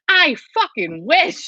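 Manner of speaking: high, slow, dripping sarcasm; sarcastic scoff. Retold or imitated. To viewers assuming big ad money, slow sarcastic scoff. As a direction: scoff at the idea of being rich.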